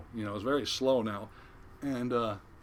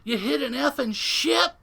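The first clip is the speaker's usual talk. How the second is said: high, loud furious shouting; imitated yelling; 14 semitones higher, 6 dB louder than usual. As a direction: yell it accusingly at the engineer. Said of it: "he starts yelling at the engineer".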